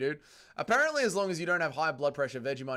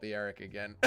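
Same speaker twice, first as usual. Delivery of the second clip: usual speaking voice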